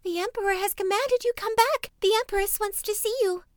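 very high voice of a winded messenger; out-of-breath servant. A narrator's reading. Servant who ran to overtake the princes, out of breath. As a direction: call it high and urgent, as if just run. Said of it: "who was out of breath".

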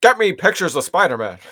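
high, loud barked order; barking newspaper editor. Retold or imitated. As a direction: bark it like a gruff editor. Quoting the Spider-Man newspaper boss.

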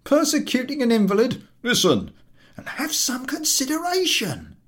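high, loud indignant rant; ranting invalid. Acted. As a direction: berate the collector.

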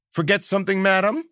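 high, sing-song innocent question; high teasing lilt; sly Kabata. A narrator's reading. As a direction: feign innocence to the crone.

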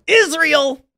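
a sudden high, loud mocking cry of "Israel!"; a hammed-up shriek of the topic; mock outrage. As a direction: blurt the word like a deranged fan.

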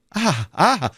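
drawn, rising cry of recognition; surprised dawning "ah"; exclaimed realisation. A narrator's reading. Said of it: "rief D'Artagnan".

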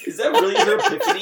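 high, fast question through laughter; laughing disbelief. Real, not acted. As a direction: squeal it out, cracking up incredulously. Laughing in disbelief at silly plot.

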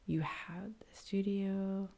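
slow hushed whisper. Pretend. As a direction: breathe the words slowly. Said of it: "quiet announcer whisper".

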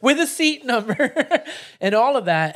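high, laughing delivery. Real, not acted. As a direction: laugh while you say it. Laughing through the retelling.

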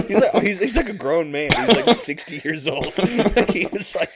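high, loud, through real laughter; laughing. Real, not acted. Storyteller marvelling at a sixty-year-old culprit, really laughing. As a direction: crack up while telling it.

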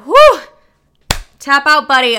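high, loud exhale of relief, then a joking quip; hammed-up worn-out relief; mock relieved whew. To her partner after sex, a hammed-up relieved whew.

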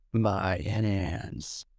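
terse, low, soft Keanu line; a low, flat two-word growl; low stoic Keanu. Retold or imitated. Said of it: "Keanu just goes".

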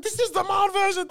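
very high, loud mock mom. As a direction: play the surprised mom up high.

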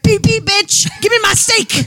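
high, squeaky pushy driver honking at the sample stand; rude golf cart driver. Pretend. Friend joking about golf carts in Costco, high mock driver voice. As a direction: play a bratty cart driver demanding steak. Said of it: "be like".

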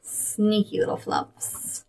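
slow sneaky whisper. Pretend. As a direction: breathe it like a secret. Whispered aside on sneaky flumps.

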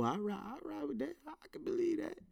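high soft aside. Pretend. Switches voice agreeing.

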